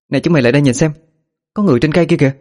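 calling out. Acted. Villager calls friends over.

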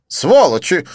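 high, loud outraged cry; exclaiming. Acted. Didier cursing the polite waiters, high loud exclaim. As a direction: curse them out loud. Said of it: "воскликнул Дидье".

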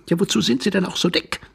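high-pitched, irritated jab; testy retort. Acted. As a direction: needle him in a high voice. Stranger retorts rudely.

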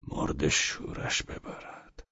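slow under-the-breath whisper; whispered thought. A narrator's reading. Velchaninov curses the scene to himself, whispering. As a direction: curse it inwardly, slow and hushed. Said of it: "ولچانینوف اندیشید".

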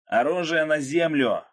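loud, high, drawn-out shout; shouted order. A narrator's reading. Akulov yelling into Krot's ear, shouted order. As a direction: shout the command slowly. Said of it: "закричал Акулов ему на ухо".

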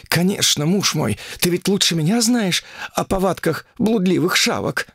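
hoarse. Acted. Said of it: "прохрипела царевна".

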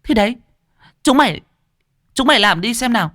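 high, scornful and dismissive; dismissive huff. Acted. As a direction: wave them off with contempt. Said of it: "tỏ ý mặc kệ".

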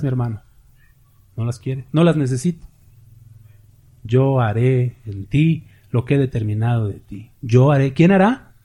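ordinary talk

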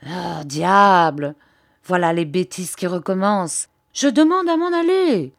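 voix changée, aiguë à la fin, mi-comique; râle comme une bergère insouciante qui veut partir; bergère gouailleuse. Acted. Narrator voices the shepherdess. (la bergère râle, voix de personnage mi-comique)

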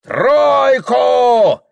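very high, very loud shout; bellowed order. Acted. Host demanding a troika, bellowing. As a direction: bellow it out to the yard.

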